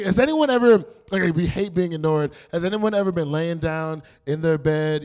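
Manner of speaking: ordinary talk, nothing marked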